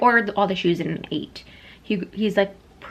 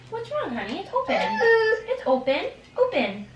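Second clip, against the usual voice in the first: high, soft reassurance; 12 semitones higher, 4 dB quieter than usual. Real, not acted. Comforting toddler.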